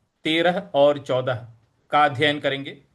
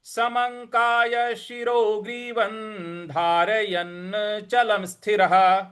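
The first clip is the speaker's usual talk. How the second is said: slow higher chant of full line; model recitation; 5 semitones higher, slower than usual. Shown as a demonstration. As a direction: recite the whole line clearly. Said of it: "इस प्रकार से बोलेंगे". (पूरी पंक्ति का आदर्श पाठ)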